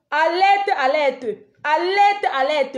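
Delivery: high, loud rallying cry; loud, insistent slogan shout. Real, not acted. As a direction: shout it out as an alarm, pitched high. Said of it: "tu cries trop".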